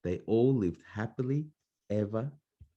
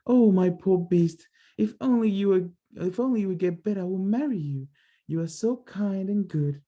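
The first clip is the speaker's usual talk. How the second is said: Beauty's voice redone; 10 semitones higher than usual. Acted. Correcting Beauty's voice for the child, lightly grieving. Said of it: "She would be more like".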